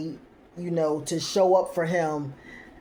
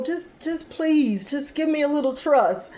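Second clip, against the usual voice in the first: high pleading plea; 9 semitones higher than usual. Retold or imitated. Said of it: "he's like".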